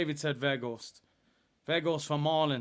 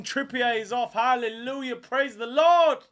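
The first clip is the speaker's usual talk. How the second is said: loud and higher, rejoicing; jubilant shout; 9 semitones higher, 10 dB louder than usual. Real, not acted. Fan celebrating a substitution to viewers, jubilant shout. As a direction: celebrate like a church hallelujah.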